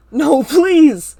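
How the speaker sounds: loud, high pleading scream; desperate yell. A narrator's reading. Narrator yells at Ava to stop, desperate scream. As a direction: beg at the top of your lungs. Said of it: "I yell".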